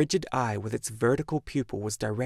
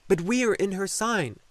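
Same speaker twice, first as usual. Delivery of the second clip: high, loud protest; crying out; 9 semitones higher, 5 dB louder than usual. A narrator's reading. Atreyu protesting the water's demand, crying out. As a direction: object urgently with raised pitch and volume. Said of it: "cried Atreyu".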